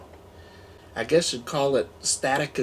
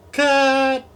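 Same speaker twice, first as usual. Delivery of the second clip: director's cut call; 11 semitones higher, 7 dB louder than usual. Pretend.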